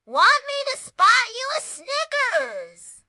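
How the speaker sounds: slow, high, loud mocking offer; teasing. A narrator's reading. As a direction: tease him with a grin.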